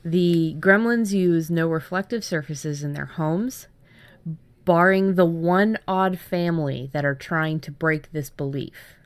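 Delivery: ordinary delivery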